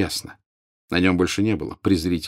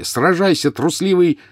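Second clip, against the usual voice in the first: high, loud demand to fight; Harry's shouted challenge; 10 semitones higher, 4 dB louder than usual. A narrator's reading. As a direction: challenge the enemy at a raised pitch. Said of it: "крикнул Гарри". (громкий высокий вызов на бой)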